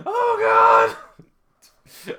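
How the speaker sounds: high loud startled shout; shocked shout. Real, not acted. Streamer shocked by racy panel, loud shout. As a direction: yell it out in disbelief.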